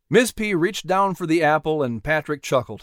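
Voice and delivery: plain reading voice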